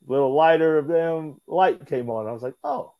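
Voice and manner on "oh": sudden high oh of understanding; dawning realization. Retold or imitated. Wrestler realizing JBL's plan, high surprised oh. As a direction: let the penny drop with a high oh.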